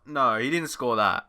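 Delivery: normal talking voice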